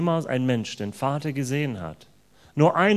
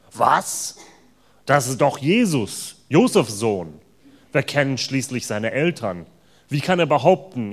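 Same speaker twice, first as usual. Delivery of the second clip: indignant, louder crowd voice; outraged; 8 dB louder than usual. Acted. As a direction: protest in disbelief at Jesus' claim. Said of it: "riefen die Juden empört". (die Juden rufen empört, laut und entrüstet)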